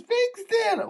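very high, soft mock whimper; crying. Sobbing passenger repeats her suburb to puzzled driver, crying. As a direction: squeal it tearfully so it is hard to make out. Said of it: "Can't make out what you're saying".

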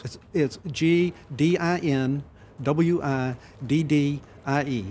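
slow "G D I N W I D D I E" letter by letter; spelling it out. Shown as a demonstration. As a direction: say each letter of "Dinwiddie" apart, slowly. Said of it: "spell that for us".

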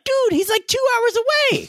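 high squeaky panic, stretched out; high mock alarm. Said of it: "I'm like".

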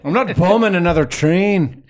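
loud, high put-on voice; gruff character voice. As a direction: bark it as a grumpy character. Acting out a character.

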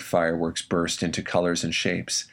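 ordinary delivery

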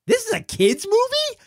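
high incredulous squeak; high disbelieving question about a film. Retold or imitated. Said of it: "I'm like".

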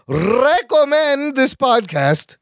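"Recommend" with a long trilled R, drawn out slowly; rolled R's. Shown as a demonstration. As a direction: roll the R in "Recommend" hard and slow. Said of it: "I rolled the R's so you would remember".

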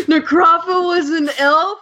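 high-pitched mock astonishment; gasp, then ask in disbelief; shocked gasp. Podcast guest re-enacting Piper's big discovery, shocked.

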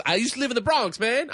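high Bronx guy voice; Bronx accent. Retold or imitated. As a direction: push it up high with a Bronx twang. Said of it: "he goes".

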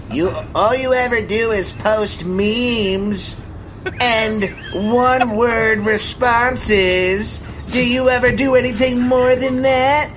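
high, slow New Jersey accent mimicking a critic. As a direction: drag the words out in a mock New Jersey voice. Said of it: "that was a good New Jersey accent".

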